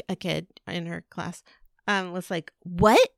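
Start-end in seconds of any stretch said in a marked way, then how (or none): 2.6-3.1 louder, higher mock outrage; indignant challenge. Retelling a classmate confronting her child, mock indignation. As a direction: imitate a shocked, confronting kid.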